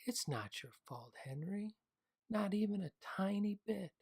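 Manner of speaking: high, loud insistence; emphatic. A narrator's reading. Mother insists to her crying son, emphatic. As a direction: press every word, firmly. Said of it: "Henry's mom said emphatically".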